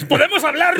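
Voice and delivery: hammed-up contractor asking to settle it; mock builder. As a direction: wheedle like a builder wanting to talk it over. Contractor voice continues.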